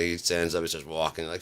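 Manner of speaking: normal talking voice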